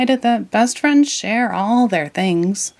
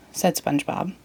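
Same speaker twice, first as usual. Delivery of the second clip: ordinary delivery, nothing marked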